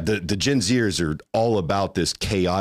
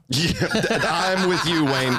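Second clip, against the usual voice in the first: laughing; 8 semitones higher, slower than usual. Real, not acted. Laughing agreement.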